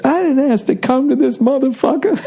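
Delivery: high, indignant quote with laughter; Richard Pryor. Retold or imitated. Comic repeating a favorite line, high indignation and laughing. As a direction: protest high, breaking into a laugh. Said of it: "one of my favorite lines he ever had".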